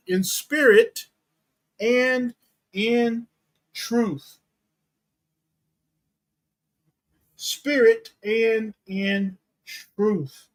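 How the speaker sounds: "spirit", "and", "in", "truth" each set apart by pauses; slow, spaced-out delivery, "truth" drawn out and falling; word-by-word emphasis. Shown as a demonstration. Preacher driving home how they must worship, word-by-word emphasis.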